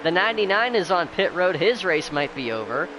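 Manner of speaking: upbeat race caller energy; excited play-by-play. Real, not acted. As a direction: call the action, lively and bright. Race call during caution.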